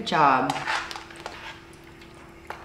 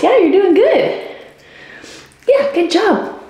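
high sing-song praise; praising the baby. Shown as a demonstration. Mom praising baby brushing teeth, high sing-song. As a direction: cheer her on in a high baby voice. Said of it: "she can kind of practice".

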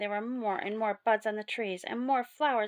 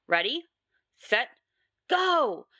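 spaced-out loud count, bursting on go; starter's call; 3 semitones higher, 6 dB louder, slower than usual. A narrator's reading. Sir Topham Hatt starts the big hunt, starter's call. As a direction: build to a high, loud "go".

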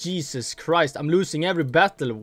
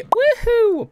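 excited whoop; 5 dB louder than usual. Real, not acted. Starting all-in round.

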